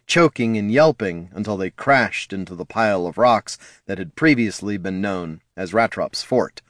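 plain reading voice

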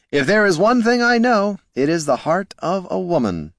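high showy boast; grand boast. A narrator's reading. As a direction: play the lover. Bard boasting.

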